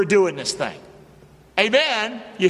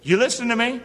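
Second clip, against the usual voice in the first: normal talking voice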